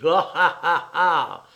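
loud, yelping mock laughter; sardonic cackle. A narrator's reading. The little one laughs at his companion, sardonic and yelping. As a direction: cackle derisively at a rival. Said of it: "glapissant avec un rire sardonique".

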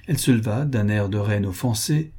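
plain reading voice

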